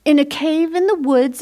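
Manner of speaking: plain reading voice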